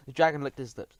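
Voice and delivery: ordinary delivery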